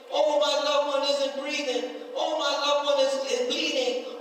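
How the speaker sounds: normal talking voice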